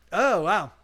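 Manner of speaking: normal talking voice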